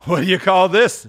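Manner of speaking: put-on high, wheezy old dealer voice; a crafty old fence welcoming them; sly old pawnbroker; old Joe.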